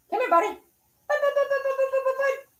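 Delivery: very high, rapid bud bud bud; a squeaky high call to the puppy; high dog call. Real, not acted. Calling the dog over.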